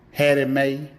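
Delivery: usual speaking voice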